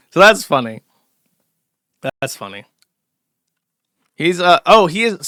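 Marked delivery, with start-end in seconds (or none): 0.1-0.8 loud, emphatic so that's funny. Real, not acted. Host reacting to replies, loud emphatic delivery. 2.2-2.6 that's funny, softer and lower; quiet deadpan. Real, not acted. Host repeating his reaction, quiet deadpan.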